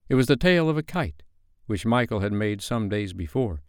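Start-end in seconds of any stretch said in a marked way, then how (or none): none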